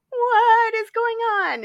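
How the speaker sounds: high, slow panicked cry; mock freak-out at the ghost. Heroine seeing the dead boy speak, panicked. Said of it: "she's like".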